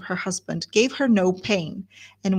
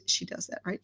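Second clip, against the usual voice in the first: ordinary talk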